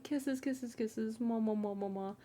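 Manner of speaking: playful kisses to viewers; blowing kisses. Real, not acted. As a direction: smack out kisses to the camera. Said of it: "signs off blowing kisses".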